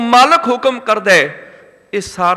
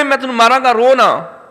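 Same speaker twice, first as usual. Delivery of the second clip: louder, higher angry threat; a father threatening his crying child; 4 semitones higher, 6 dB louder than usual. Retold or imitated.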